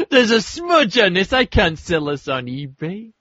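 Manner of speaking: high-pitched mock elderly whine; old person grumbling. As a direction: complain like a fussy old geezer. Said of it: "gonna be in an old people's home".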